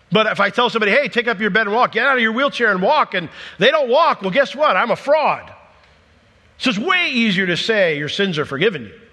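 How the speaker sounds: high, loud, driving sermon delivery; forceful preaching. Real, not acted. Preacher argues which claim is easier to make, forceful preaching.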